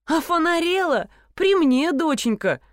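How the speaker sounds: high, indignant exclamation; scornful disbelief. Acted. Nina denies leaving her pet, scornfully indignant. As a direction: exclaim it, pitched well up.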